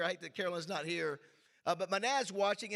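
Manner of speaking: ordinary talk, nothing marked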